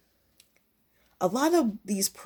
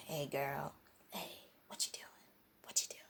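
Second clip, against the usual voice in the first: very soft, low whispery come-on; mock smooth pickup, fading into a whisper; smooth talker; 3 semitones lower, 17 dB quieter than usual. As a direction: mumble it barely audibly, mocking a laid-back guy. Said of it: "talking like this".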